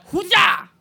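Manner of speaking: high Bruce Lee style kiai shout; imitating a kung fu kick cry; martial arts cry. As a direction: bark a karate yell. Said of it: "I did some Bruce Lee shit".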